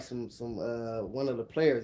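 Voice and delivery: ordinary delivery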